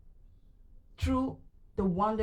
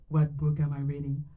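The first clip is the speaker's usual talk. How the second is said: normal talking voice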